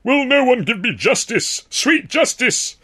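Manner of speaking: high, slow, theatrical wail of injustice; hammy declaiming. A narrator's reading. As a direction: ham it up like a wronged stage hero. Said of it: "he declaimed hammerly".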